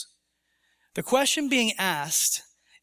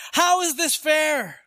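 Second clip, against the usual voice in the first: higher, indignant protest to God; rebellious questioning, voice raised in pitch; indignant challenge; 6 semitones higher, 3 dB louder than usual. Acted. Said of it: "resistant, indignant, rebellious questioning".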